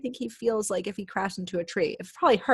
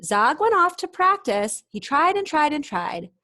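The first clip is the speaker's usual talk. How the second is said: higher, louder straining narration; building effort; 6 semitones higher, 4 dB louder than usual. A narrator's reading. Reader to children as Zog keeps trying, rising and louder. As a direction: push the effort up.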